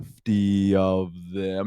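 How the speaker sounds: low, slow turtle answer. Retold or imitated. Turtle player answering the party, low slow turtle voice. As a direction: drop the bad news slowly like a turtle. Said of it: "he would talk like this".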